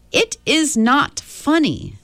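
put-on high, slow, indignant child; a little boy stamping his foot; childish protest. A narrator's reading. Charles Wallace protests to the witches, high childish indignation. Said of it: "gave a childish stamp of his foot".

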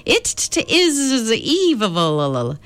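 high stammering otherworldly voice, stretched slow; halting high voice that catches on every word; Mrs Which speaking. Acted. Ancient being warns the children of darkness, halting and high.

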